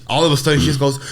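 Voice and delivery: ordinary delivery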